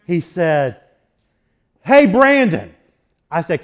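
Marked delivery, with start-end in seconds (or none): 1.8-2.7 loud mock call across distance, higher; calling out across the yard. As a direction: call out to a neighbor far away. Neighbor calls across from afar.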